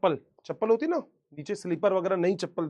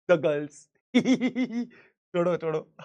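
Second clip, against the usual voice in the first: laughing through the words; helpless giggling, waving it off; real laughter; slower than usual. Real, not acted. Teacher laughing at his story, trying to move on. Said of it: "हंसी आ रही है".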